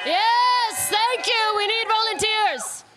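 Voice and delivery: high, excited game-host call; rally the crowd for volunteers. Real, not acted.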